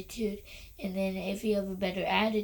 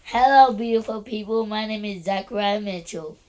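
losing his voice, straining to be heard; hoarse, voice nearly gone; 9 dB louder than usual. Real, not acted. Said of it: "he just lost his voice literally before we did this recording".

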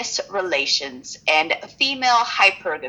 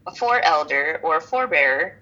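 ordinary talk, nothing marked

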